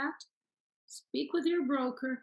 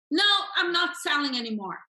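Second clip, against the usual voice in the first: higher, louder seller brush-off; firm refusal; 5 semitones higher, 6 dB louder than usual. Acted. Coach playing expired seller's common objection, firm refusal. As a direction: shut the agent down, emphatically.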